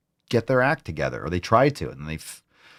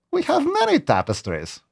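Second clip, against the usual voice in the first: much higher, squeaky proud lord; silly high voice. Acted. Host copying the ad's tapestry line in a squeaky voice. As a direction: boast in a squeaky castle voice. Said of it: "I'm really getting that voice down".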